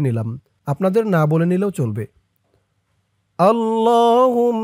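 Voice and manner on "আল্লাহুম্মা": আল্লাহুম্মা with a held doubled m; careful recitation. Shown as a demonstration. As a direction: draw out আল্লাহুম্মা slowly, lam and mim stretched. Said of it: "আমি যেরকম করে বলে দিব আপনারাও তেমন করে বলবেন". (টেনে টেনে আল্লাহুম্মা)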